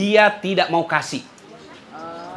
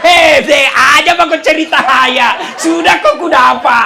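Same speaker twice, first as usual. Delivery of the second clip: loud, laughing mock yell; hammed-up shouting spouse; angry shout; 4 semitones higher, 7 dB louder than usual. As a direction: bark it at them across the room. Shouting quarrel acted out.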